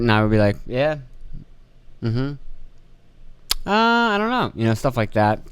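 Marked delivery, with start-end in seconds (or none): 0.7-4.5 sulky, disinterested replies. Retold or imitated. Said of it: "Kinda being a baby".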